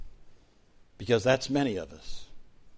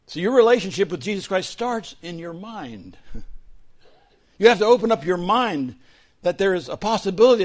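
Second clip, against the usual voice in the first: higher, louder, driving sermon voice; full-force preaching; 9 semitones higher, 9 dB louder than usual. Real, not acted. Pastor preaching to the congregation at full force. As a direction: preach it out with fire to the room.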